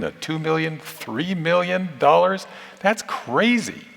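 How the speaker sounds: high-pitched, slow incredulity; mock disbelief. President denies salary rumours to students, in mock disbelief.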